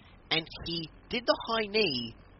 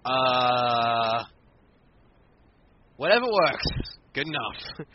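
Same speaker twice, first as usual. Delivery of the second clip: shrugging resignation; 2 semitones lower, 6 dB louder, much slower than usual. Retold or imitated. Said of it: "we all went".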